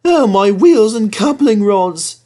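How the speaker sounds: high, loud, slow lament; sad engine. Acted. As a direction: moan over his wheels like a sorry little engine. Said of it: "said Thomas sadly".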